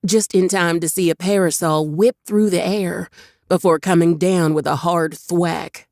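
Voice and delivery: ordinary delivery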